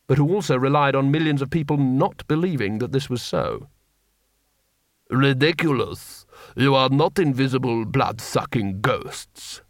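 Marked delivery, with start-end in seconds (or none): 5.1-9.6 haughty lord. A narrator's reading. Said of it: "said one of them".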